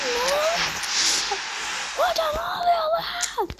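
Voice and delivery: losing it over the pull; real high-pitched shrieking; ecstatic screaming. Real, not acted.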